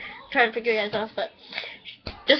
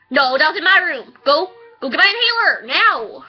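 higher, louder urgent command; real angry yell; 4 semitones higher, 4 dB louder than usual. Real, not acted. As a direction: bark the order, fed up and urgent. Ordering brother to fetch inhaler.

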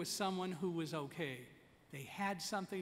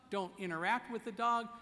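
ordinary talk, nothing marked

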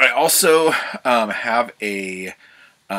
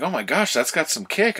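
higher-pitched mock amazement; amazed; 8 semitones higher, 4 dB louder than usual. Cowboy trying the gambler's tiny gun, amazed. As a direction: imitate someone impressed, pitched well up.

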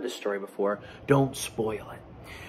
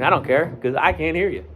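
usual speaking voice

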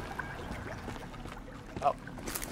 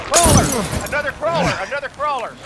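alarmed shout; 8 semitones higher, 7 dB louder than usual. Real, not acted. Enemy spotted callout.

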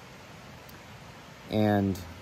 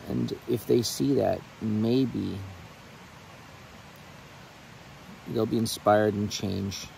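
heartfelt prayer; 2 semitones higher than usual. Real, not acted. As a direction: pray aloud to the Lord from the heart. Sorry, guys, if I get a little bit emotional.

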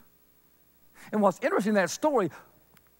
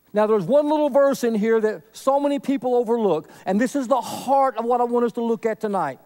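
ordinary delivery

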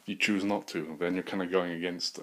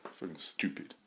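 ordinary talk

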